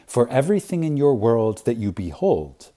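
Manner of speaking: ordinary talk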